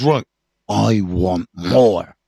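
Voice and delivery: the addict's craving talking, slow and low; greedy crazy head. Retold or imitated. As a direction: let the craving drag it out. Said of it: "money head, crazy head, goes".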